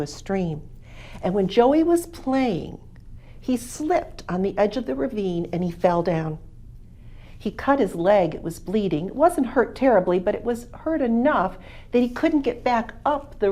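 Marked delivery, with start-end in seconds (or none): none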